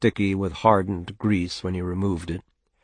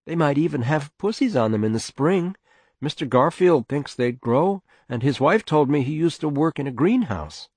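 higher voice of a wife coaxing; persisting mother; 7 semitones higher than usual. Acted. Mother trying again after a silence, high persuasive voice. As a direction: try again, pitched up and hopeful. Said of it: "she tried again".

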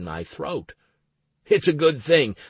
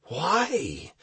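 mild wheeze on a higher, rising question; wheezed; 7 semitones higher than usual. A narrator's reading. Man just pulled from the capsule, wheezing. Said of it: "I wheezed".

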